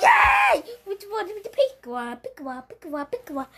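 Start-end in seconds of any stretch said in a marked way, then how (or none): 0.0-0.7 high, loud yay; happy cheer. Real, not acted. Child celebrating in a game, cheering high. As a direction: cheer with delight.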